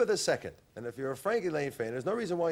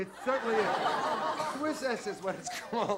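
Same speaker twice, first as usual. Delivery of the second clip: ad read; 8 semitones higher than usual. Acted. Ad pitch.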